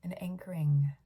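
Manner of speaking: hushed meditation voice with long pauses; guided meditation. Real, not acted. Leader guiding awareness from head to feet, hushed meditation voice. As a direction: guide them through the body, leaving long silences.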